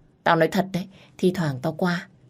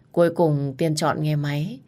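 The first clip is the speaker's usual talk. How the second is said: usual speaking voice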